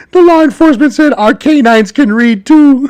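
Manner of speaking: high, loud, tickled punchline; amused punchline delivery. Retold or imitated. Podcaster sharing a police quip with listeners, highly amused. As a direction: squeal it, about to burst out laughing.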